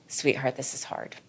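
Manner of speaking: soft, gentle self-comfort; kind to yourself. Shown as a demonstration. Speaking kindly to your own scared self, tender and soft. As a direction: comfort yourself like a dear friend. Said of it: "bring kindness to yourself".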